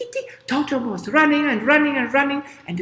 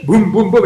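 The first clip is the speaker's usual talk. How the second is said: booming footsteps; 3 semitones lower, 8 dB louder than usual. Acted.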